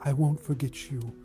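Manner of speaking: ordinary delivery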